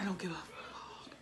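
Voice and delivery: hushed whispered aside. Real, not acted. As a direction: whisper it under your breath.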